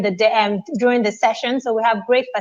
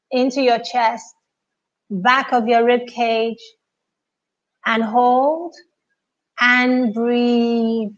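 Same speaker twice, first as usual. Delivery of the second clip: guided meditation; 2 semitones higher than usual. Acted. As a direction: guide the breath calmly, unhurried. Leads deep breathing in silence.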